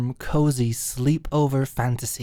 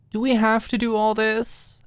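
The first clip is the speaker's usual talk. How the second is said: whining; 9 semitones higher, 4 dB louder than usual. Retold or imitated. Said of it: "whined would be the better word".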